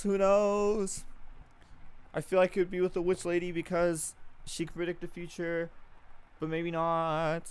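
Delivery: loud, drawn-out shocked servant voice; gossiping maid shocked at the master's affair; scandalized gossip. Acted. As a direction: dish the scandal, thrilled and aghast.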